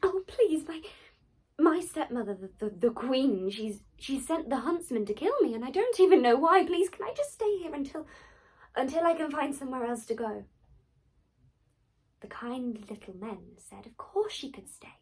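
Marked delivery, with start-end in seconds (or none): 0.0-10.4 high, loud, stammering frightened plea; begging. Acted. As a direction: beg the seven men, stumbling over words. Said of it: "She begged them to let her stay".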